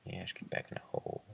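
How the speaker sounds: fast unvoiced whisper. Real, not acted. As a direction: hiss the order fast under breath. Same order repeated.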